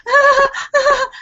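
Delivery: high gasping sobs, voice cracking; imitated crying gasps. Woman recalling her shower panic, gasping sobs. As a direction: gasp out high frightened cries. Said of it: "and I'm crying".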